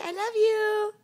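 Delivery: loud cooing prompt. Shown as a demonstration. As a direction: say it big so the toddler copies.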